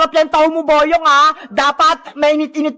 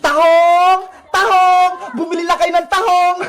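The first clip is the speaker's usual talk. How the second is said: vendor's call. Acted. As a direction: call out your wares to passers-by. Street vendor calling out wares.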